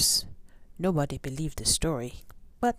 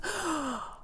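high gasp; 14 semitones higher, 3 dB quieter than usual. Acted. Giant out of breath.

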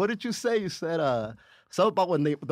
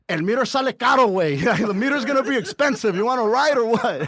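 louder, higher cab driver voice, Mexican Spanish lilt; 5 semitones higher, 6 dB louder than usual. Retold or imitated. The cab driver hustling two drunk guys, louder driver voice. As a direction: play the pushy driver with no meter.

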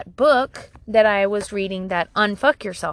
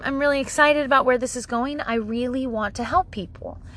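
usual speaking voice

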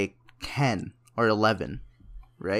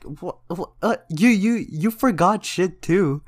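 spluttering comeback; 8 semitones higher, slower than usual. Retold or imitated. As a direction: act out flustered protest at mom. Spluttered comeback to mom, acted.

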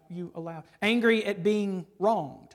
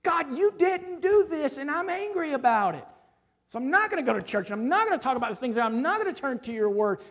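angry prayer; 6 semitones higher, 4 dB louder than usual. Pretend. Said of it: "That is their prayer".

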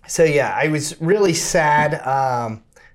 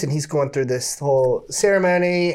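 ordinary delivery

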